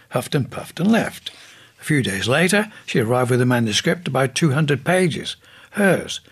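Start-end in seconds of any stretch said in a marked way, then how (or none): none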